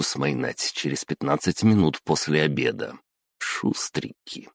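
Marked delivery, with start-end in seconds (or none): 3.4-4.5 quiet aside. A narrator's reading. Sarcastic aside on slackers.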